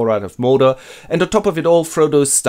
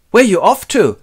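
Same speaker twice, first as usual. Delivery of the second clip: higher and louder call; mock call; 9 semitones higher, 7 dB louder than usual. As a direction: shout the question after them. Sam calling after them.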